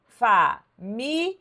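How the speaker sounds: "família" bem devagar, em fa-mi-lia, com pausas; separando em sílabas. Shown as a demonstration. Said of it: "a gente separa assim, ó". ("família" silabada pausadamente, como professora no quadro)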